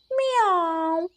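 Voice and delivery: drawn-out imitated cat meow. Cat ends its request, long meow.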